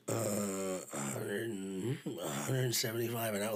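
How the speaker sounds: imitating a hesitant, reluctant price offer; retold reluctant quote, drawn out; really reluctant. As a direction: offer the figure haltingly, low and soft. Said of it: "like, really reluctant".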